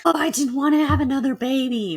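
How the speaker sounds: high, loud complaining mother; mimicking her mother's sigh of regret; imitating mom. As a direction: put on mom's put-upon voice. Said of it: "my mom was like".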